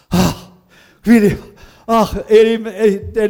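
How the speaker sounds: loud outcry. Real, not acted. Preacher overcome by the story.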